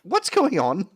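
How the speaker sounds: outraged sailor who can't believe his eyes; indignant gruff NPC. Acted. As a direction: play the scandalised crewman. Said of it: "looks down at the child and goes".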